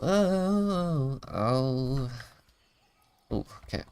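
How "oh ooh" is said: ordinary delivery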